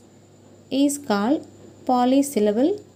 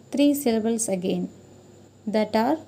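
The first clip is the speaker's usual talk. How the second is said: normal talking voice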